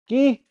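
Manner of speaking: "ki" with a sound between k and g, pitched high; K-G blend. Shown as a demonstration. Teacher drilling students on the Dakota "ki". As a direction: say "ki" halfway between k and g. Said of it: "a K and a G mixed together".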